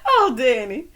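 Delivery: loud, high sigh of amusement after a laugh; coming down from a big laugh; amused, through laughter. Real, not acted. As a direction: still tickled, laughing it off. Recovering from laughing at Danny.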